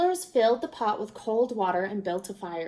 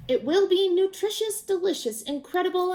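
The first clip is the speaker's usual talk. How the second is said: higher, louder salesman's patter; hyping the soup; 8 semitones higher, 4 dB louder than usual. A narrator's reading. Traveler selling the crowd on his soup, high and eager. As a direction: sell every word to the crowd.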